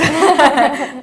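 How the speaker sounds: loud burst of laughter; amused laugh. Real, not acted. Telling the host they bonded on workouts, laughing.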